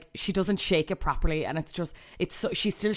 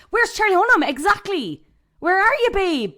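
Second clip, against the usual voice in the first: high and louder, calling for a missing actor; mock calling out; 13 semitones higher, 9 dB louder than usual. Asking where the actor went, a high mock call. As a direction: call out as if searching a crowd.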